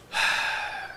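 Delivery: long, heavy exhale; drawn-out deflated sigh; defeated sigh. Acted. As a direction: breathe out in resignation. Defeated scammer sighs.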